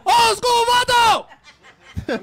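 drawn-out high shout through laughter; laughing shout. Retold or imitated. DJ greeting a fan in public by his screen name, shouting with a laugh. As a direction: call the name out slow and loud, laughing.

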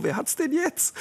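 high-pitched gloating question; mock taunt. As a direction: tease as the dog who won. Voices triumphant old dog taunting. (hohe, gespielt triumphierende Stichelei)

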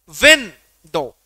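"Window" with a loud, stretched "विं"; stressed first syllable. Shown as a demonstration. Teacher showing a trochee example, first syllable of "window" stressed hard. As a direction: hit "विं" hard and let "डो" fall away. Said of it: "ट्रोकी के".

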